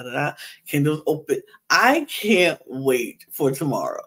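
usual speaking voice

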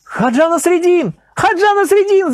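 high ringing shouts of the name; crowd shouting. A narrator's reading. Crowd recognising Nasreddin, shouting his name. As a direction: call out in recognition, rising. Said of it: "закричали в толпе".

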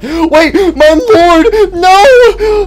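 high, loud begging cry; desperate pleading wail. Acted. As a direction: beg him to come back, wailing.

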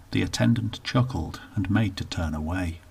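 plain reading voice

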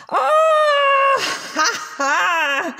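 monster crowing with glee; a beast that finally caught its prey; gleeful monster. A narrator's reading. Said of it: "s'écria la vilaine bête".

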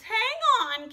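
sudden alarmed gasp, high and loud. A narrator's reading. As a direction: catch your breath in alarm. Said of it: "Katie gasped".

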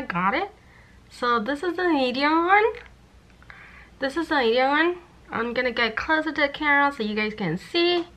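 lips held wide open; speaking through mouth stretcher; cheek retractor in mouth. Real, not acted. Seen 1: Lip retractor stretches lips open in every labelled frame; not in references.

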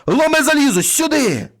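high, loud summons to a dog; ringing high-pitched cry; shouted call. A narrator's reading. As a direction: shout desperately for your dog. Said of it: "крикнув утретє".

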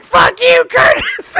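high, loud mock heckle; imitated angry heckler shout; heckling crowd. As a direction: yell it from the crowd at the stage. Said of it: "They start heckling the guy".

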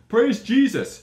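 loud, high mock church cry; hammed-up born-again shout; shouted praise. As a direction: bellow it like a revival crowd.

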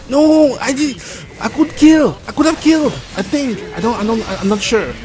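high-pitched, flustered wail; panicking. Real, not acted. Player misses a chance to kill, panicking. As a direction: fret over the missed kill in rising panic. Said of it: "I'm panicking".

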